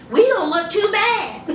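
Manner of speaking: giggling through the line; laughing aside. Retold or imitated. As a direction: let a laugh break in as you say it. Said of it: "he wanted to lean over to Andre and say".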